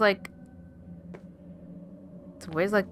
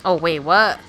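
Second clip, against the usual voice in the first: loud, sudden "wait, what?"; startled outburst; 10 dB louder than usual. Real, not acted. Player jolted by a game surprise, loud startled yelp. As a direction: caught off guard, blurting it out.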